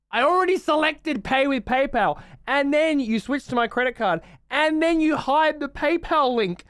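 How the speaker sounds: high, loud frustrated ranting; loud disbelieving complaint at the website; exasperated rant. Real, not acted. Ranting at Bandcamp checkout.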